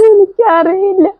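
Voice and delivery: high, slow and tearful; words choked by crying; breaking into tears. Acted. Breaks down confessing. (കരച്ചിലോടെ, പതുക്കെ)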